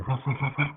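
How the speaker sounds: light playful imitation of the dogs barking; mock dog barks.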